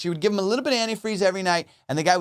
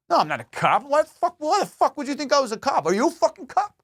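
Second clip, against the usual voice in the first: much higher, hammed-up indignant denial; over-the-top hitman; 9 semitones higher than usual. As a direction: play a fake hitman overacting outrage. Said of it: "They go over the top with it".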